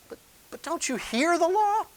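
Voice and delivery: very high-pitched disbelief as Paul; incredulous question. Acted. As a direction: stammer into an astonished high question. Voices Paul's incredulous question.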